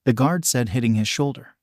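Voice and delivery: usual speaking voice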